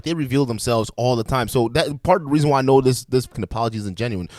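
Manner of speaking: ordinary delivery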